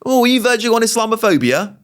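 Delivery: high-pitched mock accusing question; Trisha. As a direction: mimic an alarmed accuser, pitched well up. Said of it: "first thing that Trisha responded to him".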